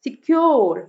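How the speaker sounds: Secure with a short सि and strong, long cure; iambic stress. Shown as a demonstration. Teacher gives iambic example, cure stressed in secure. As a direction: punch the second syllable of secure. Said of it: "cure पे stress है".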